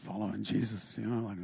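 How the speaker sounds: low, soft, sulky mumble; grumbling Peter. Acted. Peter mutters back at the mocking fishermen, low and sulky.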